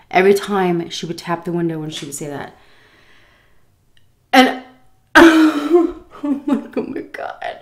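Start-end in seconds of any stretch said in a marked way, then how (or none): 4.3-7.6 laughing. Real, not acted. Laughing in disbelief.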